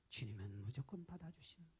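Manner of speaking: ordinary talk, nothing marked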